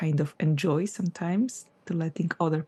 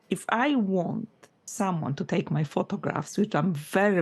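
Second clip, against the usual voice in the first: ordinary talk, nothing marked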